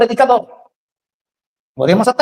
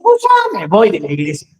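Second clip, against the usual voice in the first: mock offended huff; 5 semitones lower than usual. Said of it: "si Pedro hubiese sido jarocho".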